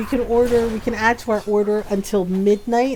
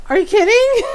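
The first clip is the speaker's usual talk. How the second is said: higher, louder incredulous outburst; can't believe what you're hearing; pitched-up are you kidding; exasperated disbelief; 9 semitones higher, 6 dB louder than usual. Retold or imitated.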